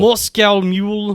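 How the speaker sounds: slow mock Russian accent; drawn-out put-on Russian. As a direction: copy it slowly in a Russian accent. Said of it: "Let me see if I can get into it".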